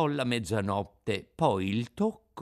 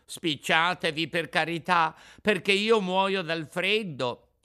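higher, urgent, louder begging; shivering plea, raised voice; pleading puppet; 7 semitones higher, 5 dB louder than usual. Acted. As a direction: beg to be let in from the cold.

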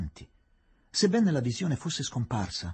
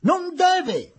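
very high, loud shriek; shouted cry; 9 dB louder than usual. A narrator's reading. Englishwoman protests in the growing tumult, shrieking high. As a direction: shriek the protest over a rising din. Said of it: "gridò missus Tarton".

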